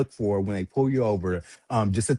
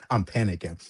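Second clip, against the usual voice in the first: ordinary delivery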